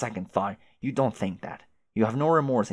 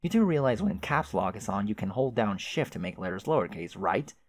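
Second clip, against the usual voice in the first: normal talking voice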